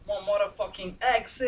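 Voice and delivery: high, loud, hammed-up southern French accent; playfully exaggerated accent from the south of France. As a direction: show off your southern French accent with swagger. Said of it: "avec mon accent".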